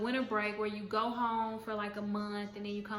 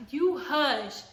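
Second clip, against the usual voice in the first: sharp command; 4 semitones higher, 6 dB louder than usual. Retold or imitated. Shutting down the hall leader.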